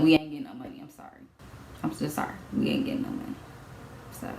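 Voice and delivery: soft, near-whispered and low; hushed apology. Retold or imitated. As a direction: barely say it, ashamed. Apologizing, defeated.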